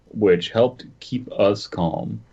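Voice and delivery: ordinary delivery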